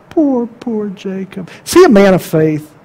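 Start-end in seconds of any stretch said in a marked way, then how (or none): 0.1-1.5 soft slow pity. Real, not acted. Sympathy repeated.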